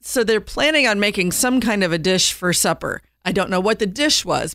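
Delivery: usual speaking voice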